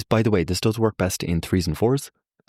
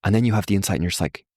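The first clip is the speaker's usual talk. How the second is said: usual speaking voice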